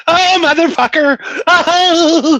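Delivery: high-pitched mock scream of terror; panicked scream. Man re-enacting his screams at a grasshopper, high panicked scream. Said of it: "The screams that came out of my mouth".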